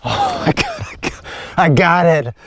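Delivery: squealing with joy; giddy high squeal; excited. Real, not acted. Just shot the grouse.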